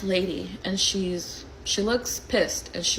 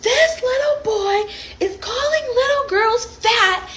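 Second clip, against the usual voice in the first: high-pitched mocking yell, drawn out; loud, high, stretched-out mock complaint; pissed-off woman; 4 dB louder, slower than usual. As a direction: imitate an outraged woman making a big scene. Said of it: "but she's still pissed".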